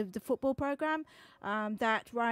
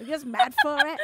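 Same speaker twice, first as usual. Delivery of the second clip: higher, loud mock Mancunian; Manchester accent; 4 semitones higher, 7 dB louder than usual. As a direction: put on a lairy Manchester accent. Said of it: "'cause of the Manchester".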